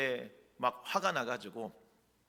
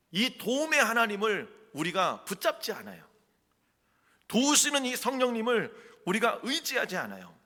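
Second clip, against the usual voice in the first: high, loud exhortation from the pulpit; forceful preaching; 8 semitones higher, 5 dB louder than usual. Real, not acted. Preacher challenging the congregation about prayer, forceful preaching. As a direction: press the congregation with raised, urgent voice.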